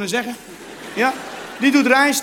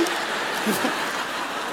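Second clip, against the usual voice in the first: quiet breathy laugh; 3 semitones lower, 7 dB quieter than usual. Real, not acted. Laugh after surprise.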